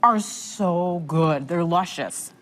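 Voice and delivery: talking through a mouthful of bagel; mouth full. Real, not acted. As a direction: chew and talk at once. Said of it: "sorry, I'm talking with my mouth full".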